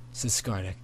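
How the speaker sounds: plain reading voice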